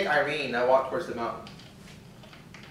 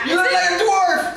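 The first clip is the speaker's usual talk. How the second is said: high, loud, drawn-out tease; real delighted shriek of laughter; laughing shout; 10 dB louder than usual. Real, not acted. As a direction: yell it while cracking up. Teasing over feathered beard result.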